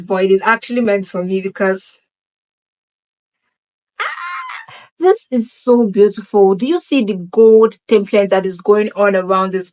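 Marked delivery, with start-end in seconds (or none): 4.0-4.7 sudden very high delighted squeal; a short shriek of delight at the glasses; excited squeal. Real, not acted. Gasps at gold aviator glasses.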